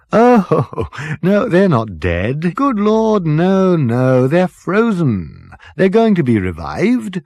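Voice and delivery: breezy dotty captain waving off worry; jolly captain. Acted. Captain laughing off Ford's worry about the frozen bodies, jolly.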